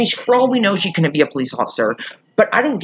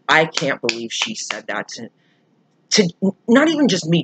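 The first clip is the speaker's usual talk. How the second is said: usual speaking voice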